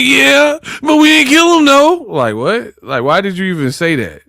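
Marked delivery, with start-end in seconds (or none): 0.0-2.0 very high, louder whiny mimicry; mock tearful confession. Steele blurting to the cops under interrogation, high mock-tearful. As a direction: squeal it like a comically panicked suspect. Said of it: "when Steele was crying".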